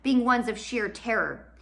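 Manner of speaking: plain reading voice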